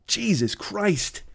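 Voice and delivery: slow, soft, disbelieving "Jesus Christ"; a slow exasperated groan of a sigh. Real, not acted. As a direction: let it drag out in disbelief. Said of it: "exasperated aside".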